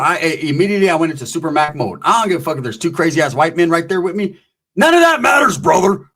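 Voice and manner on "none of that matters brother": high, loud burst; excited shout. Real, not acted. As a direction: shout it out, pumped up. Hyping himself up.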